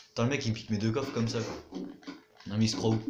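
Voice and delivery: normal talking voice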